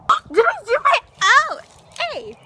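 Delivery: high, loud startled yelp. Real, not acted. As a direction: caught off guard. Startled while filming.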